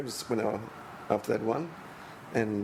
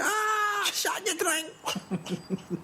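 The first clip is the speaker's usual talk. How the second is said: squealing, laughing drunk in a very high mock voice; high squeaky cry, last words dragged out slowly; Scott Brown, high-pitched; much slower than usual. Footballer gone crazy drunk at a night out, high-pitched mock voice. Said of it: "got a really high-pitched voice".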